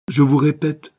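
high, loud, emphatic restatement; insistent. A narrator's reading. Adventuress overriding the detective's doubts, loud insistence. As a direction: drive it home forcefully. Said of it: "insistait l'aventurière".

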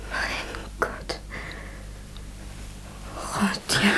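breathless whisper of shock; horrified whisper. Acted. Stunned friend whispering after the confession. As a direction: barely voice it, stunned.

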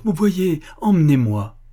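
put-on urgent childlike appeal; insistent plea. A narrator's reading. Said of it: "l'instance de sa prière".

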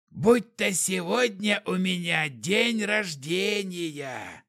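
put-on a blood-drunk vampire rejoicing. A narrator's reading. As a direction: slur it out like a happily drunk vampire. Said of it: "воскликнул он пьяным голосом".

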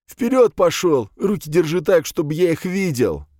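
high, forceful commands, loud at the start; shouted orders easing slightly by the end; barked gunpoint orders. Acted. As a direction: herd a captive forward. Gunman marches hero at gunpoint.

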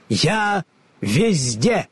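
slow, high, solemn declaration. A narrator's reading. The hidden voice answers Ellie, slow and solemn. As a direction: proclaim it from everywhere. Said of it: "торжественно отвечал голос".

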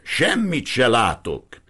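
loud, slow shout. Acted. Priest yelling back over the torrent, loud. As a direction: shout it over the noise of the water. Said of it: "ordította bele a vénember fülébe".

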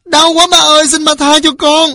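high, loud wailing plea; a beaten boy's desperate cry; howling in pain. A narrator's reading. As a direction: beg for mercy at a yell. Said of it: "Thằng Phát rống lên".

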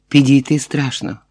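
ordinary delivery

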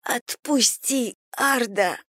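strangled high rasp, slow; wind knocked out. A narrator's reading. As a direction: plead with no air left. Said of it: "с хрипом просила она".

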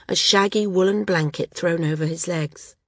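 ordinary delivery, nothing marked

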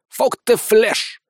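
high, slow, satisfied declaration; contented. A narrator's reading. Sergeant rubs his stuffed belly, contented. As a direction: pronounce it with full-bellied satisfaction. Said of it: "довольно заявил Сисюля".

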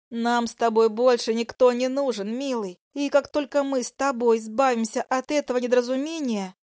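high, coaxing female voice; coaxing mistress. Acted. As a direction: purr it close to him.

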